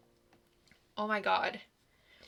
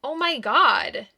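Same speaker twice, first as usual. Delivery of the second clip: shocked cry; 4 semitones higher, 7 dB louder, slower than usual. Acted. Heroine is stabbed herself.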